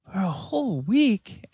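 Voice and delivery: put-on high, loud kid's protest; incredulous little-boy whine; dismayed young boy. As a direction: balk like a child handed chores. Boy balks at the condition.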